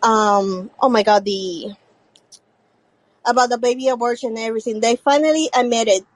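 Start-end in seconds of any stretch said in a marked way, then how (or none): none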